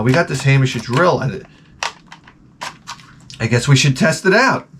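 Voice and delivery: ordinary delivery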